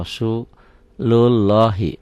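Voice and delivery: "Rasulullahi" slow, with a rounded "lohi"; spelling-style Rasulullahi. Shown as a demonstration. Teacher modelling how the Latin reads. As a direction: read "Rasulullahi" carefully, syllable by syllable. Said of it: "Salah kalau orang baca Rasulullahi".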